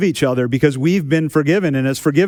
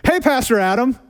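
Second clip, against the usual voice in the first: higher, bright hello from the window; cheerful kid greeting; 9 semitones higher than usual. Retold or imitated. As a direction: greet your pastor with glee. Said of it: "I hear".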